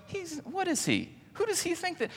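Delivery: a sneering snob muttering to himself; indignant Pharisee. Retold or imitated. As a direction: squeak with offended disbelief. Pharisee's indignant thoughts voiced.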